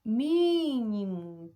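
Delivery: MÍ of "Mínimo" loud and very long; stressed first syllable. Shown as a demonstration. Teacher showing the tonic syllable, MÍ in "Mínimo" stressed. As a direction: belt and hold MÍ in "Mínimo". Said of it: "o mi".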